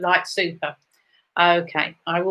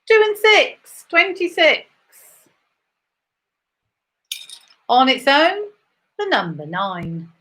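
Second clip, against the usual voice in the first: rising bingo number call; bingo caller; 3 semitones higher, slower than usual. Acted. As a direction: call each number out high.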